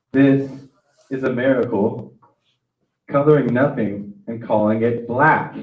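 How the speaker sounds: loud, intense spoken word; poetry reading. Acted. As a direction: declaim the poem loudly. Performs his poem.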